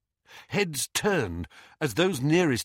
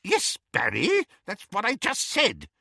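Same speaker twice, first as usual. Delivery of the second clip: testy; 11 semitones higher than usual. A narrator's reading. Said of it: "said Auntie Muriel testily".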